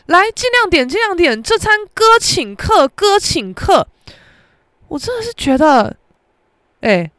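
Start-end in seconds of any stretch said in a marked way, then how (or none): 0.1-3.9 high, slow, boastful mock of him; mocking the boyfriend's grand I'm-paying act; show-off treating. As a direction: ham up a big shot offering to pay. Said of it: "他又要在那边说".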